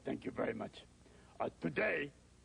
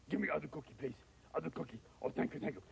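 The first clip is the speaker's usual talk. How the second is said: ordinary talk, nothing marked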